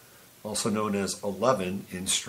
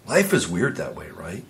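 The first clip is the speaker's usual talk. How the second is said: normal talking voice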